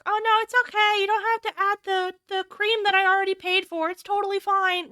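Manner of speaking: high, falsely sweet reassurance; mock polite customer. To the barista over the cream, high fake-nice voice. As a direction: gush it's fine while annoyed.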